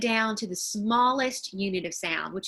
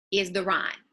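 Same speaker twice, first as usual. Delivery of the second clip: ordinary talk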